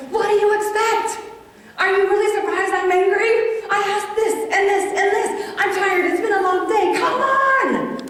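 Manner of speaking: loud, high, exasperated mom tirade; angry outburst. Retold or imitated. Tired mom blowing up at her son over undone chores, angry. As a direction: snap and let the frustration pour out loudly. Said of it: "Mom, you look angry".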